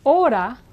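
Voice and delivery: hora with silent H and tapped R like a quick D. Shown as a demonstration. Teacher modelling hora for learners. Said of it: "the H is silent in Spanish".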